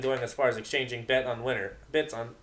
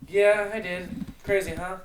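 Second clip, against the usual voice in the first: excited reply; 8 semitones higher, 8 dB louder, slower than usual. Acted. Excited answer.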